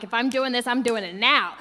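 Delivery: loud, fierce resolve; determined shout. Retold or imitated. Cutting in line before the firewalk, loud and determined. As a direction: declare it loudly, no waiting. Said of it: "I was like".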